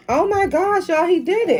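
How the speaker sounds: high, thrilled shout of disbelief; excited. Real, not acted. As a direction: cheer him on, delighted. Son finishes drink without burping.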